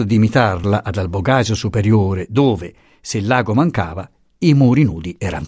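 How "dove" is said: ordinary delivery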